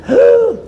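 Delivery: sharp, high audible gasp; theatrical intake of breath; shocked gasp. Acted. Storyteller reacting to the couple's fight, shocked gasp.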